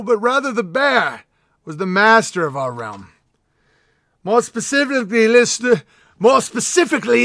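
mock-epic lore reading to the king; grand proclamation read. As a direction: proclaim it like a royal herald. Reads fan letter as proclamation.

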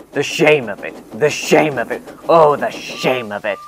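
a proud engine groaning over his disgrace; mock lament. A narrator's reading. Gordon bemoans his dirty trucks, in hammed-up lament.

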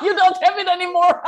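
laughing it out, high and loud; amused teasing reply through laughter. Real, not acted. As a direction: giggle through the words in delight. Amused reply, laughing, much higher.

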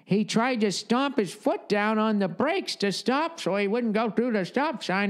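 put-on high, slow old lady storyteller voice; old lady telling tales. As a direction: spin a creepy tale like the odd neighbor lady. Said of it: "she'd be".

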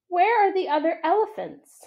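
high, anxious little voice; a calf looking for her family; searching child. A narrator's reading. Ruby asks Ivan about other elephants, high and anxious.

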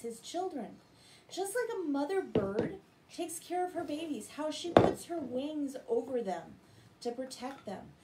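usual speaking voice

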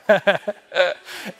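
breaking up into real laughter. Real, not acted. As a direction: laugh out loud, unable to hold it in. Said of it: "That's why he's laughing so hard".

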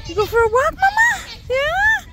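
squeaky coaxing up to the top. Real, not acted.

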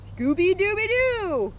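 high, slow, drawn-out catchphrase; Scooby-Doo. A narrator's reading. Scooby barks to end the story, high drawn-out cry. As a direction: stretch it into a rising dog howl. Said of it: "Scooby barked".

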